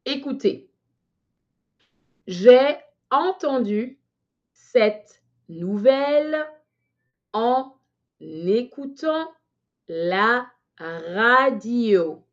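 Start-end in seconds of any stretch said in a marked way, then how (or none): 2.3-12.2 very slow, word by word dictation. Shown as a demonstration. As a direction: spell it out slowly with pauses. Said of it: "je répète lentement".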